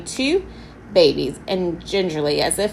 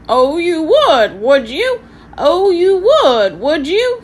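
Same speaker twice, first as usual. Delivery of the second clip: high, loud, slow squawking parrot sneer; mock them like a cheeky parrot; sarcastic parrot; 10 semitones higher, 8 dB louder, slower than usual. A narrator's reading. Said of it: "said Halfred sarcastically".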